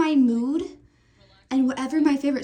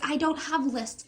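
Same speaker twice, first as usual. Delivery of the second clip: ordinary talk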